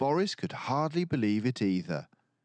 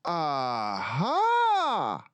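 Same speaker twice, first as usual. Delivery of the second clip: high, louder eureka aha; yelped aha; 7 semitones higher, 3 dB louder than usual. A narrator's reading. Boris yelping as he finds the answer, excited. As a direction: pop it out like a discovery. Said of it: "yelped Boris".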